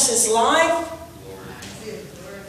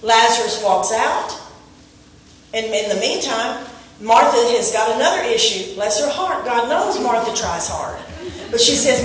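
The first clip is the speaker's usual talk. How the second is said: ordinary talk, nothing marked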